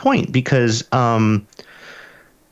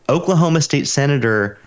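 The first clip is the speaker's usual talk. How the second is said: usual speaking voice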